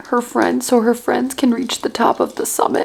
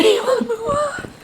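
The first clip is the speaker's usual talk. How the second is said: high, laughing through the words; laughing repeat of the line; 12 semitones higher, 5 dB quieter than usual. Real, not acted. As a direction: let the laugh break in.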